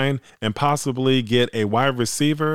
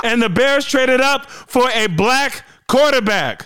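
high, loud and slow with delight; excited; 12 semitones higher, 4 dB louder, slower than usual. Real, not acted. Podcaster announcing the Bears' pick to fans, excited. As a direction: proclaim it like big news. Still celebrating the trade.

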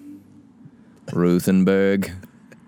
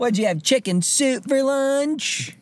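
high, slow playground taunt; high kid taunt; slower than usual. Pretend. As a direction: tease like a bratty kid. Schoolkid taunt bit.

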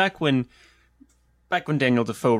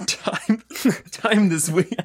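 laughing; 6 semitones higher, much slower than usual. Real, not acted. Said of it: "making the worst noises".

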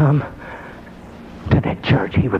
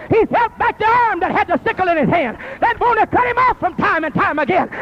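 rising preacher's fervor, higher and building; sermon voice climbing in pitch; preaching; 8 semitones higher than usual. Acted. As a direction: preach it as the story takes off. Preacher builds the story to a climax.

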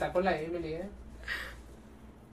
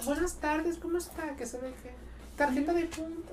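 lightly raised, softer clerk voice; mimicking a cashier's greeting; 7 semitones higher, 8 dB quieter than usual. Friend mimics her at the till, lightly higher and softer. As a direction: play the polite cashier, a bit higher. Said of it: "decía".

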